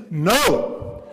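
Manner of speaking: loud, urgent retold plea; acted insistence, hitting the first word hard; intense insistence. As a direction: insist forcefully, as if it cannot wait. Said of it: "she got real intense".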